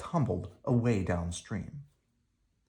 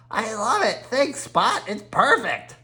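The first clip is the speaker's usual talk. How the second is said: put-on much higher, delighted child voice; thrilled child; 10 semitones higher than usual. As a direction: gush over the present like a happy kid. Henry thrilled by his gift.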